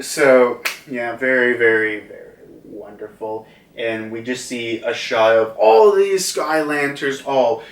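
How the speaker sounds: usual speaking voice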